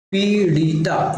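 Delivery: पीड़िता slowly as "pee-di-ta"; correct pronunciation. Shown as a demonstration. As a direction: model पीड़िता slowly, syllable by syllable. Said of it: "पीड़िता का सही उच्चारण होगा". (पीड़िता का धीमा, साफ़ उच्चारण)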